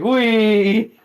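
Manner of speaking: high, loud squeal of delight; gleeful child. Acted. A child being lifted up, gleeful squeal. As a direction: whoop like a kid being lifted. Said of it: "he's like".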